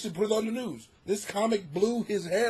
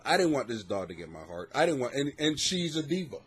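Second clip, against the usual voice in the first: usual speaking voice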